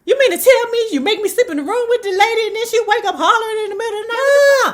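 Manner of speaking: put-on high, fast, shrill-pitched kid complaining; a scared little girl protesting she was made to sleep there; whiny scared child. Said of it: "was like".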